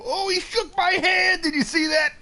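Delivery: very high, loud, squealing surprise; delighted squeal. Real, not acted. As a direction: shriek with joy at the dog's trick. The dog shakes his hand.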